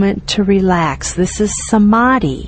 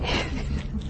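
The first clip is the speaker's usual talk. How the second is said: soft laugh; 15 dB quieter than usual. Real, not acted. Laughs after puppy demo.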